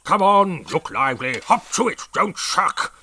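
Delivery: loud, high urging; wheezing. A narrator's reading. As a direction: urge her on, puffing. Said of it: "wheezed the brig".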